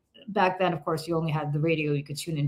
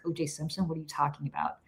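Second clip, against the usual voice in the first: incredulous whisper; 2 semitones lower, 10 dB quieter than usual. Retold or imitated. Friend John doubting the radio news, whispered. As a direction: breathe out total disbelief. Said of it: "He's like".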